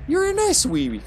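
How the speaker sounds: very high falsetto cooing; playful falsetto, as if coaxing a pet; mock baby talk. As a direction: coo it in a squeaky-high pet voice. Baby-talking to an enemy.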